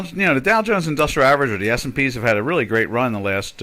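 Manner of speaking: ordinary talk, nothing marked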